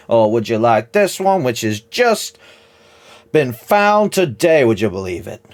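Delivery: high, loud, slow salesman voice; smarmy trader. Acted. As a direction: schmooze her like a shifty merchant.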